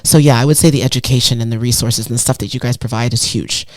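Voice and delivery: ordinary delivery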